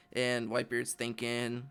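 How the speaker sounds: normal talking voice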